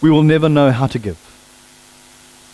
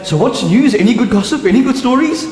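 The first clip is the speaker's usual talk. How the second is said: sister-in-law asking for gossip; 7 semitones higher than usual. Retold or imitated. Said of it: "she'll say".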